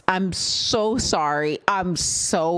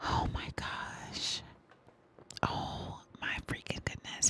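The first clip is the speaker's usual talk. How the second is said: awed whisper; 15 dB quieter than usual. Real, not acted. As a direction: whisper in awe. Awed by the song.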